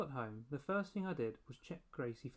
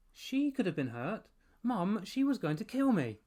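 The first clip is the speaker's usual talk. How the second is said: high, loud indignant protest; 8 semitones higher, 9 dB louder than usual. A narrator's reading. Boy protests to his mother, loud and indignant. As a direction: insist desperately she'll kill you.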